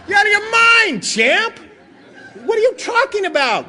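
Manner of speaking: high, loud incredulous outburst; mock outraged disbelief. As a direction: blurt it out as if he's crazy. Acted outburst at an absurd answer.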